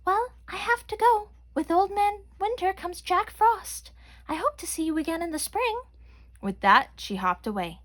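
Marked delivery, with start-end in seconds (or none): 0.1-5.8 bunny voice. A narrator's reading. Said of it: "Brianna Bunny realized".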